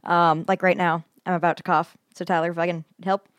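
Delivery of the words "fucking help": ordinary talk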